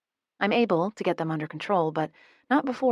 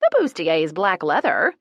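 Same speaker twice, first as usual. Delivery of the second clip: proud boast; 7 dB louder than usual. A narrator's reading. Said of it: "Emma boasts proudly".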